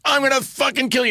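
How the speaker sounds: high mock-angry threat in a New York accent; playfully menacing, pitched high; mock furious New York threat. Dorothy actress threatening the cast, mock rage. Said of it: "she goes".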